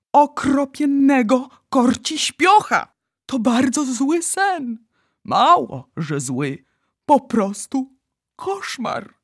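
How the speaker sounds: high, slow, anguished outcry; despairing. A narrator's reading. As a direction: announce the disaster in despair. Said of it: "popadał w coraz czarniejszą rozpacz".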